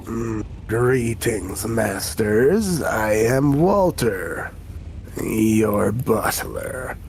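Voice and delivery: low, slow, disdainful butler voice; eye-rolling butler. Acted. Imp butler Walter introduces himself to his masters, low and disdainful. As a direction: drag it out like a bored snob. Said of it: "roll his eyes and says".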